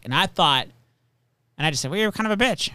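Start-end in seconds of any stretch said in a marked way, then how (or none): 1.9-2.7 retold as a joke, pitched high; joking retort. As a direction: toss it back playfully, not meanly. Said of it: "I didn't, like, say it like it was mean".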